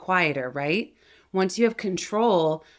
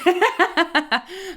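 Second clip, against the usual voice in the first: laugh; 11 semitones higher than usual. Real, not acted. Laughs at own demo.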